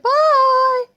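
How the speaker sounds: high, loud sing-song bye; mock farewell. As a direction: sing out a cheeky goodbye. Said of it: "And I could have said".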